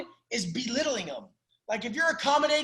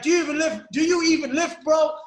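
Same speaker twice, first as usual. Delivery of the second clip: mocking gym taunt; 5 semitones higher, 5 dB louder than usual. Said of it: "they're like".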